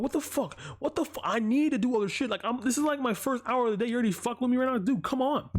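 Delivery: exasperated mock rant. His own exasperation, retold.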